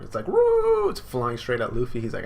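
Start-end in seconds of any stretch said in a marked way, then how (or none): 0.3-0.9 whooped very high and loud like a bird; shrill bird cry swooping in. Retold or imitated. Bird's cry as it flies.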